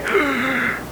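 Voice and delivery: sharp mock gasp; shocked gasp. Listener hearing of the kept vow, acted shocked gasp. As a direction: suck in air, scandalised.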